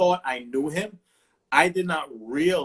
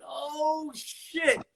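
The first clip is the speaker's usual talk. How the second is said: high-pitched amazed exclamation, voice jumping up; shocked oh shit; 10 semitones higher, 5 dB quieter than usual. Retold or imitated. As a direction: gasp it out like you can't believe the truck started. Truck starts, shocked disbelief.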